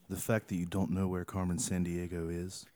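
low, soft, grand ad narration voice; mock-dramatic ad narrator. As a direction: read it like a moody commercial. Reading another tweet dramatically.

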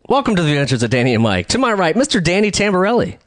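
announcer lifting high on the name; show host introduction. Acted. Host welcoming listeners to the show, announcer style.

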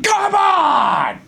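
high mock yell at the kids; Farley yelling. Friend imitating Farley yelling at the kids on the bus. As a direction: bellow like a frazzled bus driver. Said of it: "when he's yelling at the kids".

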